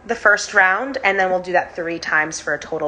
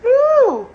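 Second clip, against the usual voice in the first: excited woo; 6 dB louder than usual. Real, not acted. After the final breathing round.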